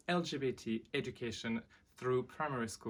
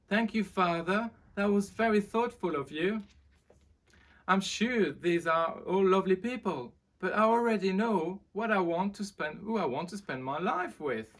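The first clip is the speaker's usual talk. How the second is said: higher, louder, nervous prince; young prince voice; 9 semitones higher, 8 dB louder than usual. Acted. Prince Henry greeting the crowd outside, higher and nervous. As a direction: greet the crowd a little unsure. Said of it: "so he replied".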